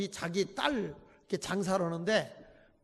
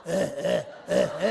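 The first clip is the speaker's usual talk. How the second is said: mock whimpering man; 3 semitones lower, 5 dB louder, much slower than usual. Man fawned over at bar.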